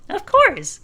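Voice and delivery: high eager voice. Acted.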